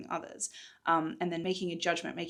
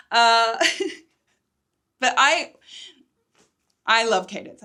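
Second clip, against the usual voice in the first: laughing fondly; 4 semitones higher, 13 dB louder than usual. Real, not acted. Narrator laughing about favourite character.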